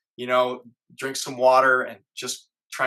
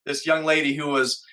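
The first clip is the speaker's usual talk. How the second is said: ordinary talk, nothing marked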